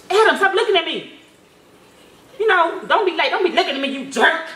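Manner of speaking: mock rude. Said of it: "Don't come to him like that".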